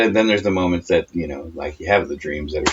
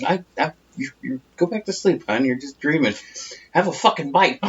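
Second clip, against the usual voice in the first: higher, humoring dad; 10 semitones higher than usual. Retold or imitated. Imitating dad humoring sleep-talking mom, higher. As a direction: soothe her like a sleepy dad. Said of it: "He goes".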